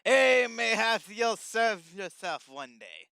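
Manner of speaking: loud, slow hearty brogue; pirate voice. Acted. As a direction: boom it like an old sailor. John character voice, hearty.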